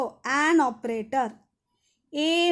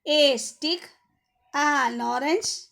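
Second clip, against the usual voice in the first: dictation read-out; 4 dB louder, slower than usual. Shown as a demonstration. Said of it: "One more time I will say the answer".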